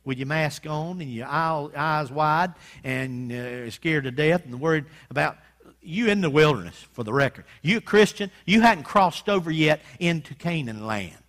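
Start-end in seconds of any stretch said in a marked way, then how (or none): none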